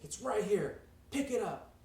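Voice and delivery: high, loud, impatient order; acted angry bellow; furious boss. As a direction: snap it at the secretary, shouting. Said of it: "furious. He's so upset".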